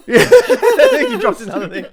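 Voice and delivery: high, loud and slow through laughter; can barely get the words out for laughing; wheezing with real laughter. Real, not acted. Laughing at the video.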